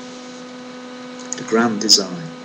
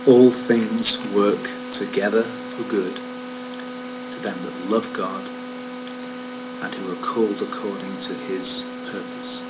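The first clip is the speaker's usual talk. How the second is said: scripture reading; 5 dB quieter than usual. Acted. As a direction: read the verse slowly and reverently. Reads scripture slowly over music.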